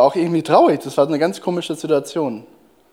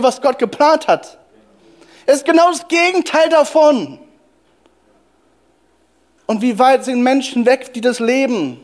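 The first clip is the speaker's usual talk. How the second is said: higher-pitched, urgent sermon delivery; impassioned preaching; 5 semitones higher than usual. Real, not acted. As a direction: preach it as a heartfelt protest. Preacher pleading at full force.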